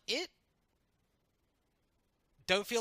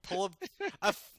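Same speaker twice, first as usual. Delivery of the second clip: usual speaking voice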